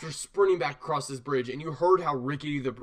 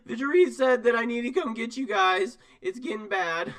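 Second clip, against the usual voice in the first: gasping, higher and louder panicked report; out of breath; 8 semitones higher, 4 dB louder than usual. Acted. Runner out of breath from the bridge, bringing bad news. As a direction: pant it after the sprint. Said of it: "out of breath again".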